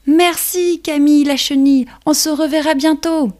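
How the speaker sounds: very high happy ladybug; cheerful little ladybug farewell. Acted. Ladybug says goodbye.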